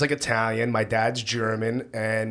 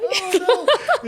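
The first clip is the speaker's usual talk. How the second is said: squeal; 4 dB louder than usual. Real, not acted. Host gushing, squeals delighted.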